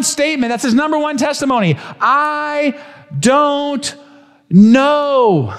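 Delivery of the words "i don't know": "I", "don't", "know" each set apart by a pause; drawn-out I don't know. Acted. Preacher repeating the blind man's number one phrase. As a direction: space out the words one by one. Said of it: "That's his number one phrase".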